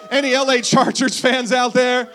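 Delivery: rally the crowd; calling out. Real, not acted. Pastor shouts out to Seahawks fans, loud rally call.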